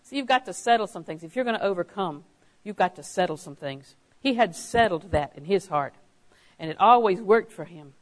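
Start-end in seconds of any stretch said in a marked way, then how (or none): none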